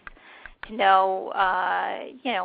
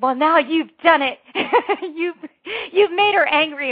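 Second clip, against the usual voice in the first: high, loud, hammed-up warning with a laugh; mock ominous warning; 8 semitones higher, 7 dB louder than usual. Telling the interviewer he upset her partner, hammed-up and loud. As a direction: play up the danger, teasing. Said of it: "I looked at him and said".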